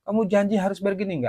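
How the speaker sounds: tiruan penagih ngotot, nada tinggi; penagih memaki. Retold or imitated. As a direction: memotong permintaan maaf dengan nada tinggi. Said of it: "saya dimaki-maki". (menirukan penagih utang yang kesal)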